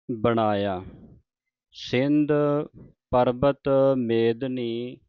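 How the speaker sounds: drawn-out devotional reading, line by line; slow scripture recitation. Shown as a demonstration. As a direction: recite it slowly like Gurbani santhiya. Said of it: "ਇੱਥੇ ਵਿਸਰਾਮ ਹੈ". (ਹੌਲੀ ਹੌਲੀ ਪਾਠ ਦਾ ਉਚਾਰਨ)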